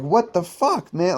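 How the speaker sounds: high incredulous outburst; lightly voiced disbelieving sergeant. Staff sergeant sees them walk in, incredulous. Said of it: "was like".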